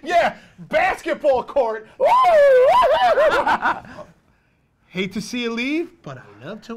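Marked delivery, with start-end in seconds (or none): 0.0-4.1 loud, slow, laughing jeer; mocking shouted laugh. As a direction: shout the joke while laughing.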